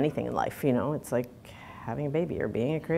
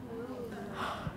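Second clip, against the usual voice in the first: sharp shocked intake of breath; gasp; 9 semitones higher, 15 dB quieter than usual. Real, not acted. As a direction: gasp in disbelief. Shocked at sexist remark.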